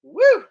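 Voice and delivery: sharp, very high and loud woo; loud whoop. Real, not acted. Host reacting to the rant with a loud whoop. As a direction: let out a stunned whoop.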